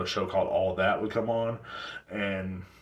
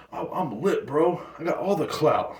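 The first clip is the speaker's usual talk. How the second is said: higher, cocky slang voice; mock young hype kid; 7 semitones higher than usual. Imitating kids bragging, lightly raised voice. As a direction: brag like a clout-chasing kid. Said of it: "All I gotta do is act like that".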